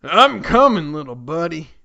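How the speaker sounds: a loud hammed-up call to his buddy; mock heroic exclaim. Donkey Kong rushing into the mansion, loud hammed-up call. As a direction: shout it like a cartoon hero.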